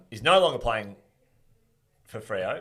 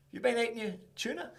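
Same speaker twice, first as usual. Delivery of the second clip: high, sing-song mock question; sugary high teasing coach voice; 13 semitones higher, 5 dB quieter than usual. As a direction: send up the coach sweetly quizzing him. [name] mock-quizzing player.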